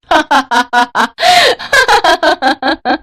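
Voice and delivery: high, ringing staged laughter; mocking goddess laugh. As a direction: laugh long and scornfully, high in pitch. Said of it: "जोर जोर से हंसने लगी".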